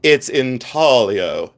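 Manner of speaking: It's intaglio said slowly, with the G dropped, in-TAL-io; mock posh correction, intaglio without the G; slow, haughty It's intaglio with no G; snooty silent-G intaglio. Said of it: "they tip their top hat to me".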